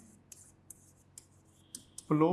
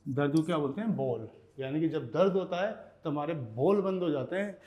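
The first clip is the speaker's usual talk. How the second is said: ordinary talk, nothing marked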